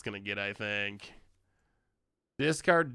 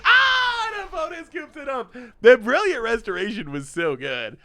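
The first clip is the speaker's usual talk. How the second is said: high, loud gleeful crowing; thrilled the opponent took the bait; delighted; 10 semitones higher, 8 dB louder than usual. Real, not acted. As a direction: crow with delight. Opponent scoops up the card.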